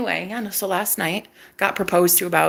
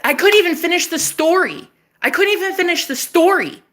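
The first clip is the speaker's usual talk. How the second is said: high, emphatic repetition; animated exclaiming; 8 semitones higher than usual. Real, not acted. Woman recounting his interruption, animated exclaiming. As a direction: exclaim it in lively exasperation.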